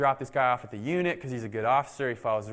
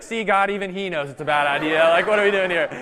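louder, rising, exasperated to God; exasperated appeal; 4 semitones higher, 9 dB louder than usual. Retold or imitated. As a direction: throw up your hands at God.

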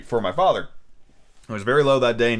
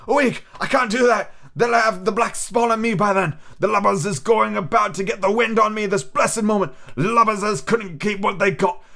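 put-on raised, rough old seaman wheedling for rum; a sick old sailor coaxing the boy; higher, louder pirate voice begging; old pirate captain; 10 semitones higher, 3 dB louder than usual. Captain raging about pursuers.

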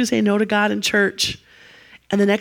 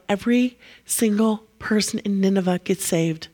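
ordinary delivery

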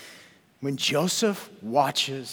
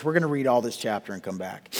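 ordinary delivery

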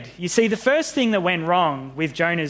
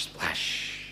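very soft, low trailing splash; whispered echo; 12 semitones lower, 12 dB quieter than usual. Acted. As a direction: let it die away like an echo.

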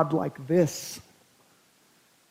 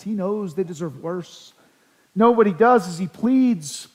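usual speaking voice

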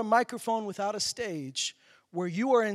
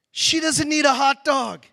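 louder, higher and slowed, half laughing in disbelief; exasperated outburst; 6 semitones higher, 9 dB louder, slower than usual. Retold or imitated. Recounting the stadium fan to the congregation, exasperated outburst. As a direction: spell out the obvious in exasperated disbelief.

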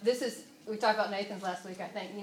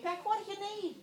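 much higher, tender asking voice; God gently asking what she needs; 11 semitones higher, 3 dB quieter than usual. Retold or imitated. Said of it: "he's like saying to me".

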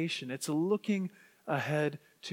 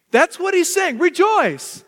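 high, loud proclamation easing slightly; emphatic, exuberant; 11 semitones higher, 10 dB louder than usual. Acted. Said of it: "It's loud".

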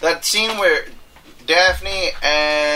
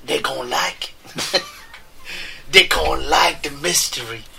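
attempted Cajun accent; 2 semitones lower, 3 dB quieter than usual. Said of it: "is that better?".

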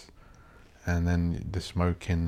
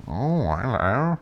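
slow, drawn-out mock posh English; 3 semitones higher, much slower than usual. Speaker mocking toffs by contrast with a normal mate, posh accent. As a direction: put on an exaggerated posh greeting. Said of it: "I just hate the posh voice".

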